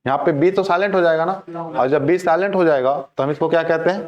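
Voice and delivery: ordinary delivery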